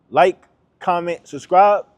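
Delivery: hyped outro. Real, not acted. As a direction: pump up the viewers as you sign off.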